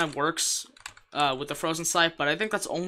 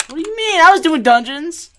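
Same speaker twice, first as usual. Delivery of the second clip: indignant shout; 13 semitones higher, 13 dB louder than usual. Real, not acted. Protesting a teammate.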